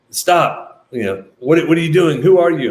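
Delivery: high, loud alarmed questioning; startled soldier's challenge. Retold or imitated. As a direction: bark it like a startled young soldier stopping strangers. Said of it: "he was like".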